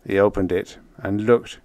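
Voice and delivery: ordinary delivery, nothing marked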